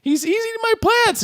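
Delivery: very high mock-distressed squeal; hammed-up squeaky protest; Melinda Dillon. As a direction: wail in comic dismay, high-pitched. Imitating Melinda Dillon's horrified cry.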